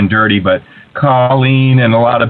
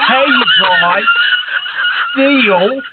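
put-on terrible Australian accent; high, slow, drawn-out Aussie show-host impression; hammed-up Australian host calling for callers; Steele; 13 semitones higher, slower than usual. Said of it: "That's my terrible Australian accent".

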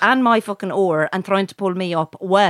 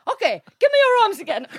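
high, quick mimicked order; masseuse; 11 semitones higher, faster than usual. Masseuse asking client for arms, high quick imitation. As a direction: imitate her briskly, pitched way up. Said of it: "And she goes".

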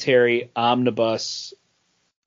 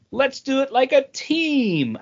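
higher and slow, hammed-up heroic rally; mock team spirit; 10 semitones higher than usual. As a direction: parody a cheesy team-up line. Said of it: "when they were like".

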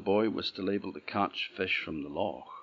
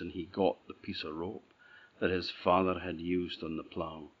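ordinary delivery, nothing marked

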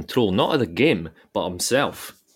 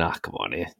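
mock groan; 4 semitones lower, 9 dB quieter than usual. Mocking rival fans' complaint.

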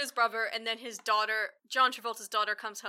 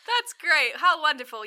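mock cheerful; 5 semitones higher, 10 dB louder than usual. Friends react to face removal.

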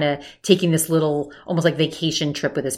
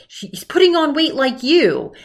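high mock mom voice, pitched way up; mother's jab; 10 semitones higher, 3 dB louder than usual. Mom needling her son about his weight, high mocking voice. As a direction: mimic his mother needling him, much higher. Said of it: "his mother says".

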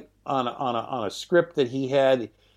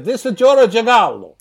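louder, higher hammed-up Italian accent; mock Italian caller, thick accent; over-the-top Italian accent; 8 semitones higher, 7 dB louder than usual. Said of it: "most over-the-top and unbelievable Italian accent".

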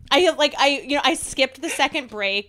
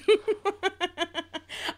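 high giggle, trailing on; real, sustained giggling; laughing; 8 semitones higher, 4 dB quieter than usual. Real, not acted. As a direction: crack up at your own joke. Host cracks up at own tattoo joke.

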